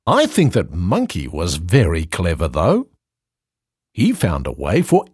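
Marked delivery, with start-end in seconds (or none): none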